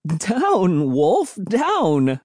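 high-pitched excited command; excited cry. A narrator's reading. Narrator to jumping dog, cried out high. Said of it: "I cried, laughing as the dog stretched".

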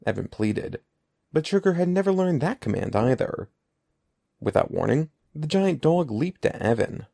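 ordinary delivery, nothing marked

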